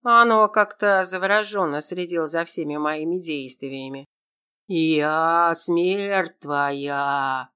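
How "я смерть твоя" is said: very slow, drawn-out ghostly wail; sepulchral howl. A narrator's reading. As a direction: moan it from beyond the grave. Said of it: "провыла загробным голосом".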